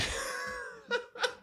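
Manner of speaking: laughing fit; sudden high laugh. Real, not acted. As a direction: burst of laughter. Breaks up laughing.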